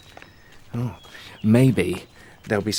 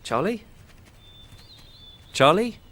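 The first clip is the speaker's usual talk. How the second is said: calling out; 9 semitones higher than usual. Acted. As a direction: call her name, rising and searching. Calling out for missing friend.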